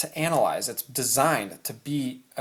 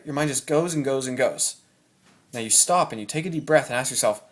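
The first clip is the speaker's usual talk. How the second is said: ordinary talk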